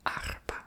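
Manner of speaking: whispered "Arpa", r rasped in the throat, stress on ar; "Arpa" with a guttural throat r; throaty r. Shown as a demonstration. Tongue-tied whisperer tries Arabic, throaty r. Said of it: "I do the r with my throat".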